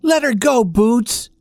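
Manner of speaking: high, begging toy voices; pleading dolls. Acted. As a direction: beg the kitten to let go.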